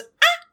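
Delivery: urgent wordless ah sounds; warning ah ah ah. Retold or imitated. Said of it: "She goes".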